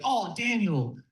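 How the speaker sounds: ordinary talk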